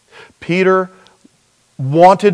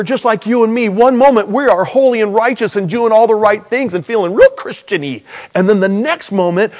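higher and louder, rushing confession; excited; 6 semitones higher, 4 dB louder than usual. Acted. Preacher to congregation, excited higher and louder. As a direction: raise the energy, admitting our folly.

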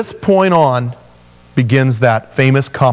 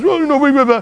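much higher dismissive whine; mock whining; 11 semitones higher, faster than usual. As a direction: brush off God like a sulky kid. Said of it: "it's so funny".

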